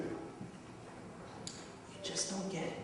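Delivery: very soft, slow reproach; hushed, nearly whispered reprimand; quiet rebuke. Retold or imitated. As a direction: let the rebuke land in a near whisper. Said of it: "he rebukes Peter".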